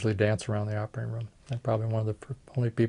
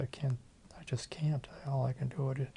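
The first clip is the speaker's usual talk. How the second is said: quiet refusal; 3 semitones higher, 11 dB quieter than usual. Retold or imitated. Telling a crying father no.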